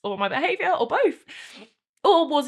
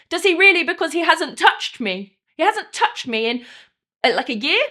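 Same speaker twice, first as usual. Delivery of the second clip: loud, raised-pitch outrage; indignant hurt; 5 semitones higher, 8 dB louder than usual. Acted. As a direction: throw it out loudly, wounded and incredulous. Wife recounting husband's confession, hurt.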